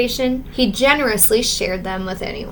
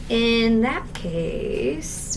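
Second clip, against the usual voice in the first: slow, softer sheepish line; hesitant confession; sheepish hush; 6 dB quieter, much slower than usual. Acted. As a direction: ease into the bad news nervously. George sheepishly confessing more.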